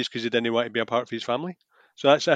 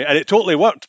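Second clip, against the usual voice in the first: normal talking voice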